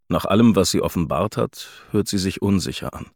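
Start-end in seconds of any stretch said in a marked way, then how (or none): none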